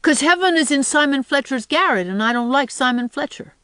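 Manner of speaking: put-on high, emphatic child voice; insistent small boy. A narrator's reading. Boy explains why he won't go to heaven, insistent. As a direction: argue back as a stubborn little boy.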